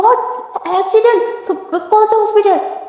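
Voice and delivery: high, alarmed disbelief; shocked. Retold or imitated. Girl hearing her friend was hurt, shocked. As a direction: gasp out the question in alarm.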